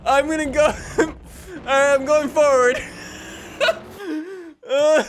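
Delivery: words broken by laughter; laughing. Real, not acted. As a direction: try to talk while still cracking up. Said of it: "I'm crying".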